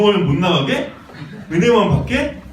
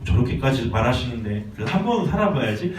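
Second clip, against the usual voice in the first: soft, lower, laughing mock of a listener's resolve; mock inner thought; 7 semitones lower, 7 dB quieter than usual. Pastor mocking a listener's fleeting resolve, soft and laughing. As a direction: play the half-hearted promise, chuckling. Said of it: "지금 여기서 들을 땐".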